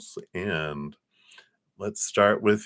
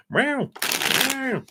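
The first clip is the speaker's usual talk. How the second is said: very high playful meowing; cat meow. Pretend. Greeting the kitty coffee chat with high meows. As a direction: meow like a little kitten.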